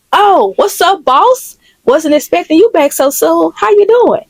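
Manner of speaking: high-pitched, overfriendly cover-up; startled greeting. Acted. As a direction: greet the boss brightly, caught off guard. Caught slacking, greets the boss.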